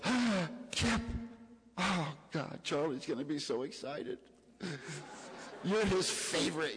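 gushing, high-pitched delighted woman; excited greeting. Retold or imitated. Charlie's wife welcomes Kip at the door, excited.

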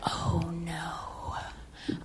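breathless whispered oh no; a hushed, horrified whisper; whispered dread. Retold or imitated. Dread as partner hangs up.

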